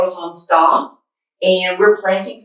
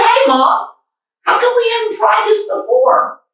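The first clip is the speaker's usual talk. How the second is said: put-on much higher, eager kid; little boy voice. Finn asking his mom, high eager little boy voice.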